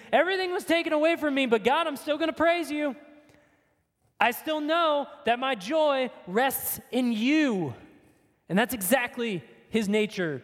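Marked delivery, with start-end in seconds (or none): 0.1-7.7 higher, fast, eager voicing of Job; voicing Job's praise. Preacher voicing Job's faithful praise to his congregation. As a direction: Job praising through loss, a little higher and quicker. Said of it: "Job is reacting".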